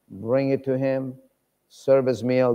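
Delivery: normal talking voice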